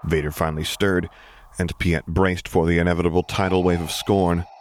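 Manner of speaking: ordinary delivery, nothing marked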